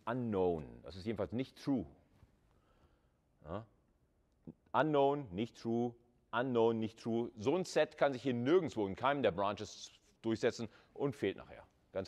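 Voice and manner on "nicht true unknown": ordinary talk, nothing marked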